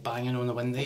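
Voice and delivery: ordinary delivery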